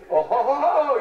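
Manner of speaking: high, loud mock "Oh, ho, ho"; hammed-up hooting cry. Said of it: "you cry".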